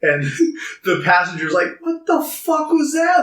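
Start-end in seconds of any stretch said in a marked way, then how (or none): 1.8-3.2 high, put-on startled cry; mock shock. Taxi passenger seeing a turtle, mock shocked outburst. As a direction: imitate a freaked-out passenger.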